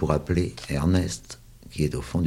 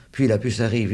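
ordinary delivery

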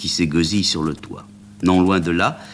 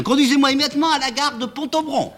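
cri suraigu et pressé; ordre crié; faster than usual. Acted. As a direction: ordonner dans la panique. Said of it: "en criant". (Amanda s'engouffre dans le taxi en criant son ordre, voix suraiguë)

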